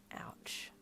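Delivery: whispered, understated ouch; sore. Acted. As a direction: let out a tiny breath of pain. Sore after getting piercings.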